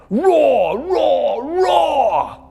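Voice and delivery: high, loud roaring bear; playful loud bear roar. A narrator's reading. Bear roars on stage.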